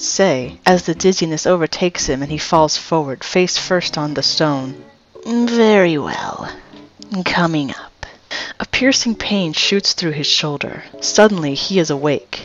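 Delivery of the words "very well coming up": slow snake voice fading to a whisper; talking snake. Acted. Snake granting a request for water, slow and whispery. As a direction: draw it out, then let it turn whispery.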